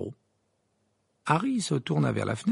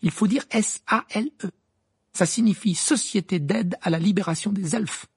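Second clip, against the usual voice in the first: put-on higher-pitched, keen girl explaining her cause; earnest Hermione; 8 semitones higher, 4 dB louder than usual. A narrator's reading. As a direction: insist on the name with zeal. (voix d'Hermione, militante et convaincue)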